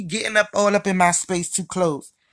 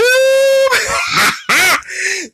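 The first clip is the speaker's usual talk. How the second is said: high, loud laugh; high burst of laughter; 4 dB louder than usual. Real, not acted. Laughing at her own joke about shared men, loud high laugh. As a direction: crack up out loud.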